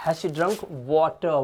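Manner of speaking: put-on Has she drunk water? spoken very slowly, word by word; slow model sentence. Shown as a demonstration. Teacher modelling the sentence for students, very slow. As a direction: read it out slowly for the class to repeat.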